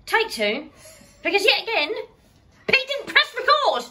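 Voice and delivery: squeaky fed-up complaining; high exasperated voice. Real, not acted. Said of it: "Why are you talking like that?".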